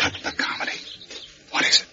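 sharp whisper; whispered rebuke. Acted. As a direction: hush him with a whisper. Whispering at the door.

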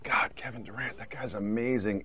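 hushed, low admiring aside; softly imitating an awestruck fan; mock awe. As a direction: murmur it like an impressed viewer. Imagined viewer awed by Durant.

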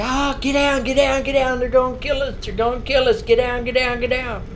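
high-pitched frantic panic; panicked. Retold or imitated. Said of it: "She says".

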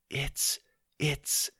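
falter, lost for words in awe; struggling for words. Acted. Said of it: "struggled to find the words".

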